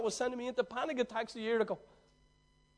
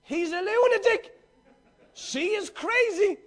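mock crazy; 7 semitones higher, 6 dB louder, slower than usual. Mocking what people said.

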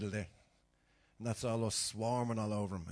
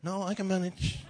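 imitating his mother; 7 semitones higher, 4 dB louder than usual. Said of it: "My ma's terrible".

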